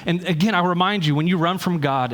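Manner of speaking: ordinary talk, nothing marked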